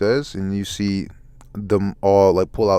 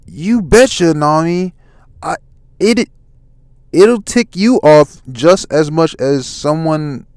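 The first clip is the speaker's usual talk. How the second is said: high, loud character voice; acted heated reply; emphatic retort; 8 semitones higher, 5 dB louder, slower than usual. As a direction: answer back forcefully, pitched up. Luffy answers Nami emphatically.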